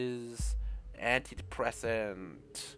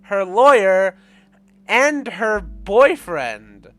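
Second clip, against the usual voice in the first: high, loud, slowly drawn-out mockery; mocking; 7 semitones higher, 14 dB louder, much slower than usual. Mocking the game's lawyer-boyfriend reveal, loud and high. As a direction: tease it out like a gossip. Mocking the game character's line.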